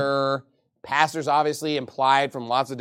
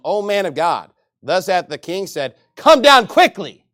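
mocking captain; 2 semitones higher, 8 dB louder, slower than usual. Second captain taunts Elijah, loud sneering command. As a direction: order him down with scorn.

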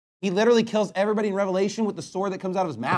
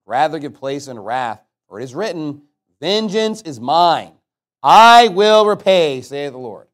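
slow, weighty reading of scripture; 3 semitones lower, 7 dB louder, slower than usual. Acted. Preacher reading Romans to the congregation, slow and solemn. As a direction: read the verse with solemn authority.

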